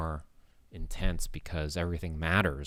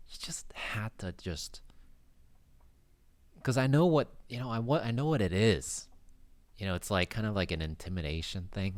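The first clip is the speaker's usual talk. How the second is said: normal talking voice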